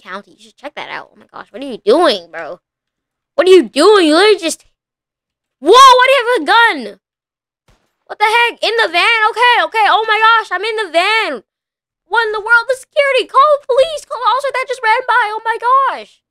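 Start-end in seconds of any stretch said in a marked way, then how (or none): 3.4-7.0 high, loud panicked outcry; startled yelling at the driver; alarmed shout. Real, not acted. Gun pulled in the van. 8.1-16.2 high-pitched frantic pleading; shrill calls for help, voice up high; panicked high voice. Real, not acted. Kidnapped in the van.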